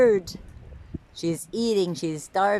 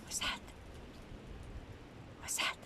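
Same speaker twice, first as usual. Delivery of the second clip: breathy whispered question; softly whispered curiosity; hushed whisper; 22 dB quieter, faster than usual. Real, not acted. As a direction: whisper so you don't scare the bird. Hushed question while watching.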